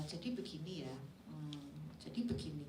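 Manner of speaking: soft, low, halting mumble; dull hesitant presenting. Shown as a demonstration. Poor presenter stalling on camera, dull and hesitant.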